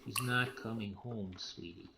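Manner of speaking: soft, slow and low, fading away; gentle sad. Acted. Father telling his daughter her mother is not coming home, soft and sad. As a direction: break the news tenderly.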